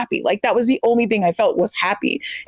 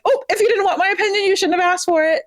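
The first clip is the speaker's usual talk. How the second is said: sassy comeback; 10 semitones higher, faster than usual. Retold or imitated. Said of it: "this came up at work the other day where I was like".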